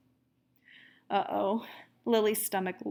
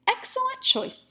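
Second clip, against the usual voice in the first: very high pleased teacher voice; delighted teacher. Acted. Teacher praising her class in a high voice. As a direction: praise them brightly.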